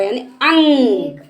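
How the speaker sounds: यानी अंग said slowly, stretched; slow drawn ang. Shown as a demonstration. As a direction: draw out अंग for the class. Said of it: "ये हमारी अंग की मात्रा". (अंग को धीरे खींचकर बोलना)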